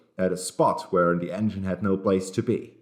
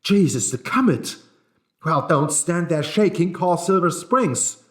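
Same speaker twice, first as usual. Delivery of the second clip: alarmed; 8 semitones higher, 4 dB louder than usual. A narrator's reading. Alarmed agent hearing of wreck.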